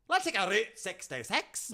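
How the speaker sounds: put-on high, loud, broad Scottish accent; exaggerated Scottish woman's voice, pitched high; Scottish lady accent. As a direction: order like an older Scottish lady. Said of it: "mature Scottish ladies".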